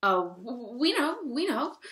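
high, loud, flustered cry; frantic bluffing claim. A narrator's reading. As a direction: blurt it out eagerly, stumbling at first. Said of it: "cried the two sisters".

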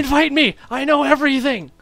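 high, loud hammed-up Irish brag; mock Irish boast. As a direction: shout a cocky boast in an Irish voice. Continuing Irish Jacksepticeye bit.

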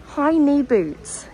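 says "high knee boots" slowly and unsure, words swapped; "high knee boots", hesitant slip; wrong word order. Real, not acted. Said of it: "Is that the right word?".

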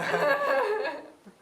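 loud burst of laughter; big laugh. Real, not acted. Laughing at the guess.

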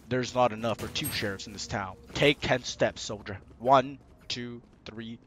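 low, soft gunslinger voice; western duel standoff. Pretend. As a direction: count down like a duelling sheriff.

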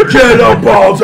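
loud, high cheer for an ally; rallying shout. Pretend. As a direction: yell to spur a fighter on. Player cheers on a teammate's attack.

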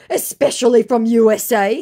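high, pleading mock voice of the scammer. Reading the scammer's message aloud in a high mock voice.

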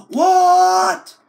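loud high gasp then a shocked What; hammed-up disbelief; mock gasp.

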